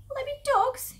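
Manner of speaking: high, loud cub voice; frightened fox child. Acted. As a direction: ask as a scared little one. Frightened small fox asks.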